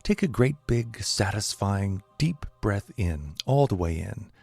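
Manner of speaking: calm, unhurried meditation voice with long pauses; guided meditation. Acted. Teacher guiding listeners to relax, calm meditation voice.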